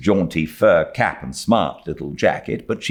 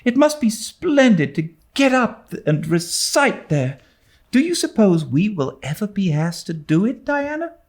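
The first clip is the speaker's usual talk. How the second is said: put-on much higher girlish voice, dreamy and wondering; a starry-eyed girl confiding her excitement; high dreamy schoolgirl wonder; young girl Anne; 13 semitones higher than usual. A narrator's reading.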